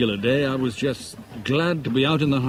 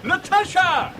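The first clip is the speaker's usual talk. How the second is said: high, loud call across the water; sudden shout of recognition; 4 dB louder, slower than usual. Acted. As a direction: call her name to catch her attention. Spots her, calls out across ferry.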